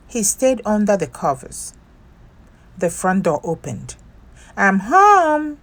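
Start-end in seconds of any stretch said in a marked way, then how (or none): 4.6-5.6 high, loud call through the house. A narrator's reading. Nora coming home, calling out to the house. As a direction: call out from the front door. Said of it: "Nora called".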